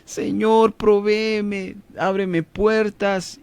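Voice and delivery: high, slow imploring prayer; acted plea for provision, drawn out; pleading needy believer. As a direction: beg the Lord in a high, stretched-out voice. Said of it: "cuando tienen necesidad de provisión".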